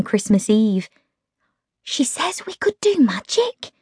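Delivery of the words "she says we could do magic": high, hushed, unvoiced question; awed whisper. A narrator's reading. Meg asking Holly about magic, in a whisper. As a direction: whisper it like a child at Christmas.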